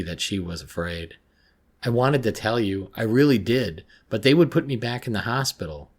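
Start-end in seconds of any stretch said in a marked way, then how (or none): 1.8-5.9 higher, louder, upset pleading; near incoherent distress. A narrator's reading. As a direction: plead it as if barely holding together. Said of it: "I was just about incoherent now".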